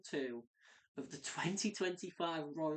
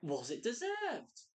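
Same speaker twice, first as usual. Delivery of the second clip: mocking; 5 semitones higher, slower than usual. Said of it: "most of the online stuff I read is like".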